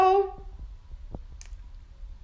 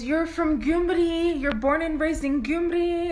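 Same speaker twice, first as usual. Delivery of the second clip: imitating the lady; 7 semitones higher, slower than usual. Lady voiced, insistent, slow.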